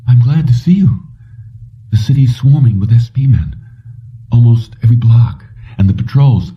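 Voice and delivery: a hint of Kelly warning him; Kelly, relieved. Kelly to Jennings at her door, lightly voiced worry.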